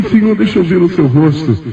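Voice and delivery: high-pitched retold plea; pleading. Believer begs the Lord to show his face, high pleading.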